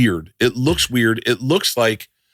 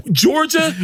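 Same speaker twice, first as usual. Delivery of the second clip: ordinary talk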